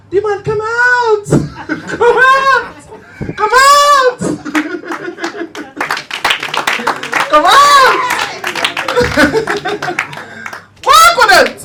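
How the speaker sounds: shouting high and loud, over and over; a pastor roaring at the demon to come out; deliverance shout. Retold or imitated.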